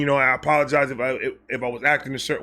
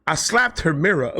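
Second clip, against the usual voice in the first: usual speaking voice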